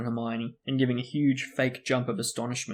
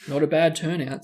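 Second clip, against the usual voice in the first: proud; 3 semitones higher, 7 dB louder than usual. A narrator's reading. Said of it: "said Nearly Headless Nick proudly".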